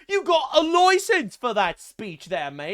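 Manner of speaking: mock British accent. Vlogger mocking English speech laws, jeering British accent. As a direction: lay on a cartoon British copper.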